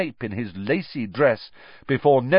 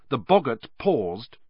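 plain reading voice

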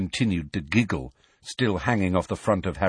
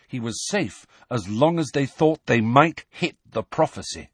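usual speaking voice

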